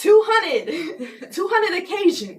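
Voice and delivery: high, loud words through laughter; cracking up mid-sentence; loud laughing repeat. Real, not acted. Laughing while retelling.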